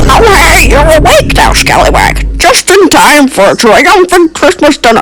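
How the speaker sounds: high, swooping villain voice; gleefully wicked Grinch. Acted. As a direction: play a Grinch relishing his mischief. Plays the Grinch in story.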